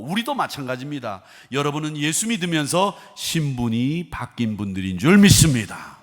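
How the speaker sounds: loud, high pulpit proclamation; forceful preaching. Real, not acted. Preacher telling believers their status has changed, forceful and loud.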